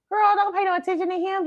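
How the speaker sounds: high-pitched sassy dismissal; drunk advice. Retold or imitated. Drunk girl to one crying over a dude, high dismissive advice. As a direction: wave her off in a high voice.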